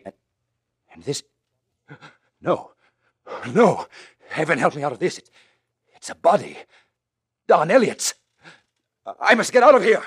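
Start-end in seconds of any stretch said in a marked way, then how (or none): 2.4-10.0 high, loud rising terror; horrified cries at a body; panicked. Acted.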